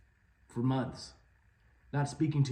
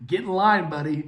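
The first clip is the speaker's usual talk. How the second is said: laughing scoff; 5 semitones higher, 10 dB louder than usual. Real, not acted. Laughing scoff at gym goals.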